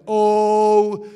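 loud, solemn "Oh" as the Bible calling out; booming "Oh". Retold or imitated. Preacher mimics the Bible calling out, booming. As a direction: belt out "Oh" like a voice from scripture. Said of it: "when the Bible starts speaking to you like".

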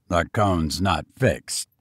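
ordinary delivery

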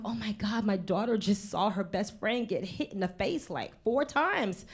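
hushed, freaked-out inner voice. Retold or imitated. Parent worried daughter saw friend get hit, hushed panic. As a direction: fret under your breath. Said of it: "freaked out 'cause I'm like".

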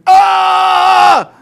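high loud mock scream. Retelling a man shot mid-call, loud mock scream.

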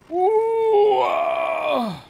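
sudden high shout of shock; loud shocked yell; startled shout. Real, not acted. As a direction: yell as the kart gets hit. Crash in the game.

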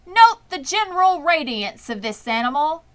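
grand loudspeaker voice; fair announcer. A narrator's reading. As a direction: boom it out to the whole fairground.

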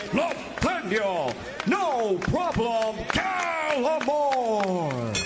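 ordinary talk

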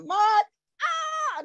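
high, choked cry of the name; real emotion breaking into a cry; emotional call. Real, not acted. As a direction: call out his name, overcome. Host calling up a tearful speaker.

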